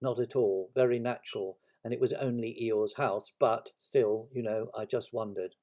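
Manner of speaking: ordinary delivery, nothing marked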